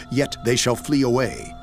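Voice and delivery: usual speaking voice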